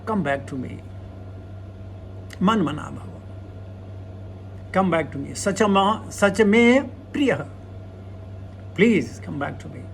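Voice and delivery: softly voiced loving Lord calling the soul back; the Lord pleading. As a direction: gently call a child home. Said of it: "The Lord says".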